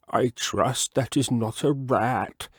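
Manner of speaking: slow, mildly nervous frog; a touch of worry in the frog; lightly voiced uneasy frog; wary frog voice. Mr Jeremy Fisher's voice.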